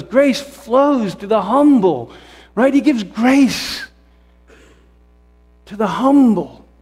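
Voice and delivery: fervent sermon climax; preaching at full force. Real, not acted. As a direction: drive it home from the pulpit.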